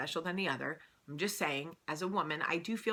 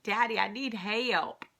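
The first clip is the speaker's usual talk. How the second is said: higher, slow imitated kid's country twang; 5 semitones higher, slower than usual. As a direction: stretch "help" into extra syllables with a country twang. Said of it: "How many syllables did that word have?".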